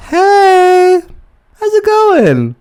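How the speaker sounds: very high, mock-casual voice; mocking his text. Mocking the guy's text, very high mock-casual. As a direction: parrot his breezy message high and sing-song.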